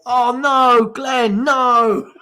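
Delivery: high, loud mock plea; mock pleading. Fan pleading with Glenn Hoddle on TV, hammed-up and high. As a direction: beg Glenn not to say it, hammed up. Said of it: "And I just thought".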